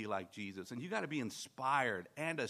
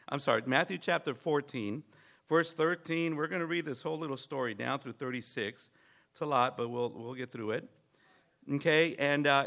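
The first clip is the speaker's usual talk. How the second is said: ordinary talk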